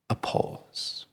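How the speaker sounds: trailing breathy whisper. Acted. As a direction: breathe the words out and let them hang. Introducing the pause.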